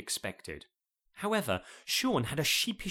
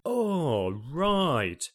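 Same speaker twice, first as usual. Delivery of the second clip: sudden realisation; 4 semitones higher, 5 dB louder than usual. Acted. Sean suddenly catching on.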